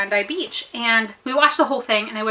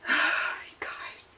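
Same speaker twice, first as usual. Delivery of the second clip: sighed, whispered aside; exasperated whisper; 13 dB quieter than usual. Real, not acted. Storyteller to viewers, exasperated whisper. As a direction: breathe it out, barely voiced.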